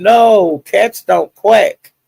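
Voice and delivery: a mock incredulous correction, drawn out; retold protest. Friend correcting Big Baby on live.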